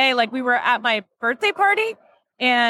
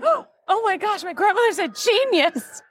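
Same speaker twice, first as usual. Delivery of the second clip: amazed; 7 semitones higher than usual. Retold or imitated. Amazed that oil worked.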